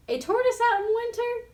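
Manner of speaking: a high, playful echo; echoing the animals. A narrator's reading. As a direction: tease it back, drowsy and amused. Sleepy Shelley echoes animals.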